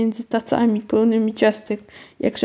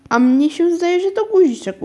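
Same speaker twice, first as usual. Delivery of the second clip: high, louder, insisting aunt; insistent; 8 semitones higher, 3 dB louder than usual. A narrator's reading. Aunt disagrees with her niece about the bead, insistent. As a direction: stick to your opinion, pitched up. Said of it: "upierała się ciotka Agata".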